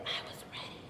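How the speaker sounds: hushed, confiding whisper. Acted. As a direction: drop suddenly into a soft whisper. Voices harem women, whispered.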